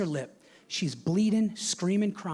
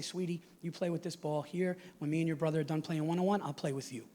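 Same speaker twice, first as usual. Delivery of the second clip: put-on quiet, gentle voice for a little girl; soft sweet-talk; 6 dB quieter than usual. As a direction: speak softly, as if to a small child. Dad soothing little daughter.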